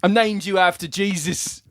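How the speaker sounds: high, hammy fatherly apology; mock dad voice. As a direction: play the proud silly dad. Mock dad addressing son Jesus.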